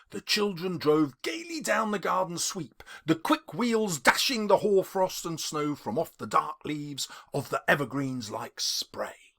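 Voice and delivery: ordinary delivery, nothing marked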